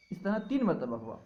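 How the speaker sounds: ordinary delivery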